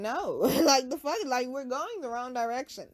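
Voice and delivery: high, loud incredulous retort; indignant refusal. Retold or imitated. Woman rejects his offer of a book for sex, indignant. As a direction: refuse in sheer disbelief.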